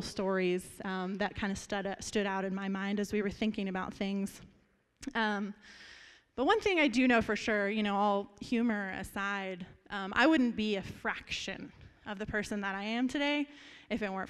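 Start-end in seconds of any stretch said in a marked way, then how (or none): none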